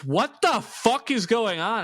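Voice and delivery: mock angry dad. Dad running out at the screaming, mock angry high yell. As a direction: play the woken father barking out. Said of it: "My dad came running out and goes".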